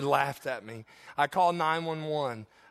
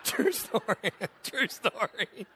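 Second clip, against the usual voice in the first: laughing; 4 semitones higher, slower than usual. Real, not acted. Preacher cracking up at own joke.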